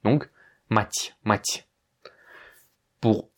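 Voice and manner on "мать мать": "Math" ending in ty, no vowel; soft final t. Shown as a demonstration. Teacher repeats the correct form, soft t. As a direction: Mathieu with the eu chopped off in "Math". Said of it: "tu enlèves le E à la fin".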